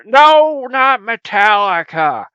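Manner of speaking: high, loud "no", slow "not Metallica"; emphatic correction. Acted. Host correcting his partner who misread the logo as Metallica. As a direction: correct him firmly.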